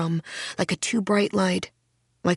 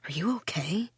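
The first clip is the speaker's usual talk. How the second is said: whisper; 8 dB quieter than usual. Pretend. Imagined stranger asks softly.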